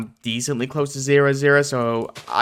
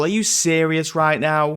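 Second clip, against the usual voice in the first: ordinary talk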